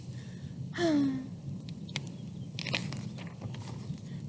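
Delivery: long breathy demonstration sigh. Shown as a demonstration. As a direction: show them a big tired sigh. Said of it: "You know what a sigh is?".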